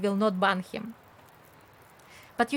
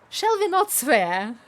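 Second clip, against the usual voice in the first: mock enthusiasm; 4 dB louder than usual. Real, not acted. Mock suggestion to chat.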